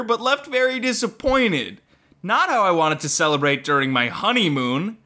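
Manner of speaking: high, slow, complaining reviewer voice; whiny reviewer voice. Acted. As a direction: read it like an offended customer. Reading one-star review aloud.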